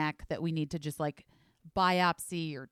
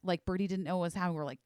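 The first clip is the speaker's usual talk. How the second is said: ordinary talk, nothing marked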